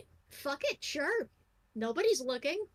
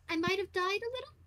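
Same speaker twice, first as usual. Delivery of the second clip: sheepish, hesitant admission with a high lilt on the end; sheepish confession; 7 semitones higher than usual. Acted. Belle sheepishly admits to the samurai he died. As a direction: play down a death like a small mishap. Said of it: "No, uh, how do I put this?".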